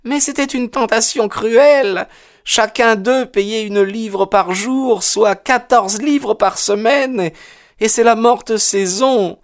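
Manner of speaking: high, slow woman's voice; rueful mother. A narrator's reading. Widowed landlady excusing herself to the detective, rueful and high. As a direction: plead hardship slowly, pitched high. Mother justifies keeping the lodger.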